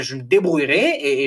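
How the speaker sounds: normal talking voice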